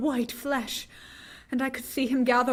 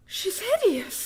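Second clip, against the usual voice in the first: horrified gasp; 12 semitones higher, 4 dB louder than usual. Acted. Mina recoiling from Lucy's body in horrified shock. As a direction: gasp then cry out in horror.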